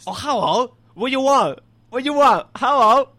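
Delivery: high, loud caricature of a Chinese accent; badly faked, high-pitched Chinese accent; bad mock Chinese accent. Shown as a demonstration. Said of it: "I think it was Chinese".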